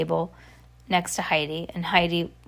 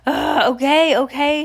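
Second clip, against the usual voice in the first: louder, higher grudging "okay"; exasperated; 5 semitones higher, 5 dB louder than usual. A narrator's reading. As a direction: give in with a groan. Heidi gives in, fed up.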